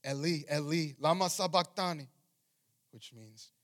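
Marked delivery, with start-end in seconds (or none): 0.1-2.1 raised, louder reading of a cry. A narrator's reading. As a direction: cry it out loudly as scripture. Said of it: "Jesus cried with a loud voice".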